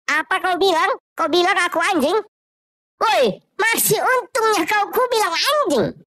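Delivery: high, loud furious comeback; angry retort. Acted. Man caught peeing shouts back at the guard, furious. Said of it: "Pria itu pun jadi marah juga".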